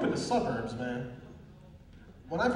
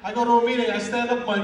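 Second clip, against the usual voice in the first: ordinary talk, nothing marked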